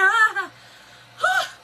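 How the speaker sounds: high loud laughing-crying gasps; panicked gasping cries. Retold or imitated. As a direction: gasp in terror, half laughing.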